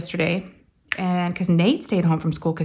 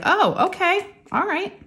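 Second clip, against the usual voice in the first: high mock okay; 13 semitones higher, slower than usual. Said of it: "I was like".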